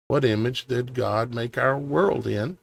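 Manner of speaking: ordinary delivery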